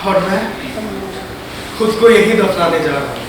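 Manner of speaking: low, soft and sinking; desolate resignation. Acted. Artist going to bury his own soul, hushed desolate resignation. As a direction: let the voice drop away as if giving up.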